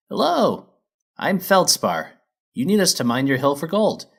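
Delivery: high, loud, cheery; chipper miner mare. Acted. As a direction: bounce in like a keen foreman. Miner mare introduces herself.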